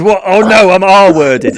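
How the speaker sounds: loud, high, drawn-out mock dismay; hammed-up cry of alarm; mock shocked realisation. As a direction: act out a horrified discovery, slow and loud. Acts character's shock at mirror.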